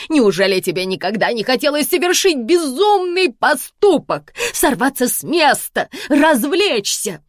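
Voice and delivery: high, loud theatrical indignation; mother scolding her dull daughter; indignant mother. Acted. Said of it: "возмутилась мама".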